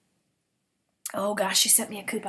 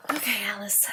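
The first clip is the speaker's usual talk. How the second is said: whisper. Real, not acted. Hushed whisper to the dozing dog.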